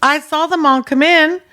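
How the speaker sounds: rolling his eyes, fed up; exasperated. Retold or imitated. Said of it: "And he goes, "Ugh".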